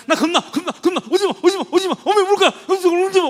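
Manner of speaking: small dog barking out its fear; scared yapping dog voice. Retold or imitated. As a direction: panicky little dog. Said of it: "이게 짖는 거라고".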